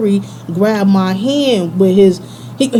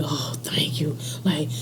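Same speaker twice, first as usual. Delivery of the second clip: soft, whispered aside; barely audible, breath only; whispered reaction; 5 semitones lower, 14 dB quieter than usual. Retold or imitated. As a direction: imitate someone in a hushed whisper. Acts Darnell's reaction, unvoiced.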